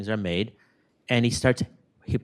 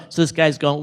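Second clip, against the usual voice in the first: ordinary delivery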